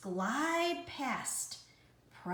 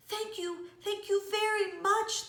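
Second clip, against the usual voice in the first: much higher, bubbling over with joy; playful bubbly thanks; 13 semitones higher, 4 dB louder than usual. Acted. Little blue fish thanking the rainbow fish, bubbly playful thanks. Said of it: "bubbled playfully".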